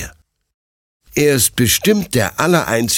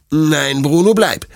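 firm, louder, higher command to stay; stern; 7 semitones higher, 4 dB louder than usual. A narrator's reading. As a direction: forbid the dog firmly. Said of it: "sagt Detlef streng".

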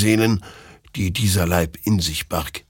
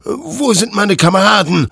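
much higher, louder defiant threat; threatening; 10 semitones higher, 6 dB louder than usual. A narrator's reading. Hector threatening the creature, loud and defiant. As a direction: stand your ground against the demon. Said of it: "drohte Hector".

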